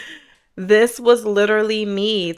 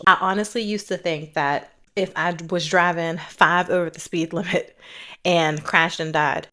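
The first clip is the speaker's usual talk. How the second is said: usual speaking voice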